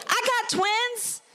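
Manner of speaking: put-on high, eager, pleading teen voice; squeaky eager camp girl calling for attention; self as eager teen. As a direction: push your chest out vocally, hey hey look at me. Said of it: "And I'd be like".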